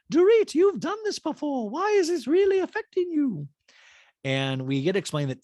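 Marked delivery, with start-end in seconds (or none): 0.1-3.5 Dorit impression. Dorit praising PK in dual talking head, high put-on voice. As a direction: coo it in a squeaky voice. Quoted voice in session.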